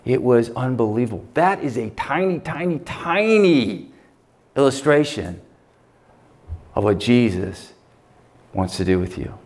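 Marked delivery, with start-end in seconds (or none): 1.3-3.9 tiny, tiny, tiny drawn out and rising; drawn-out emphasis. Real, not acted. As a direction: stretch each tiny slowly, rising.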